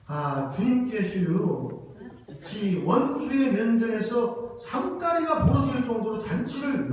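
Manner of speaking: slow, loud, drawled Chungcheong dialect reading. Shown as a demonstration. Pastor performs the psalm for the congregation in a slow, folksy Chungcheong dialect. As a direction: stretch the verse out in a homely Chungcheong dialect. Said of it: "충청도 버전으로 한번 좀 보여드리겠습니다".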